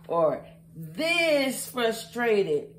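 dragged-out "this frustrated"; slow mock frustration. Shown as a demonstration. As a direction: stretch it slowly for effect. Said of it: "how frustrated have I been".

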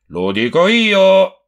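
furious reply. A narrator's reading. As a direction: plant each word like a threat. Quarrel over the bottles. (risposta furiosa, più forte e lenta)

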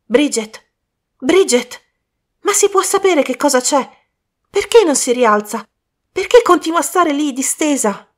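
high, loud, mounting alarm; acted panic; alarmed calling. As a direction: call out and demand answers, growing frantic. Alarmed calls to unmoving girl.

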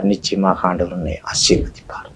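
softly whispered, close and certain; whispered assurance. Acted. Preacher assuring the believer of God's blessing, whispered. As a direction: lean in and whisper the promise.

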